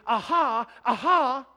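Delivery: loud, high jeering Aha aha; mocking enemies. Preacher voicing the mocking enemies, loud jeering. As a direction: jeer it out like a taunting enemy. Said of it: "who say to me".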